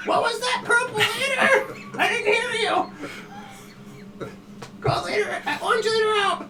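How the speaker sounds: very high, loud squadron radio chatter; hammed-up high-pitched radio call; mock radio comms. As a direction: play a squealing, garbled pilot on comms. Joking garbled radio comms over screeching.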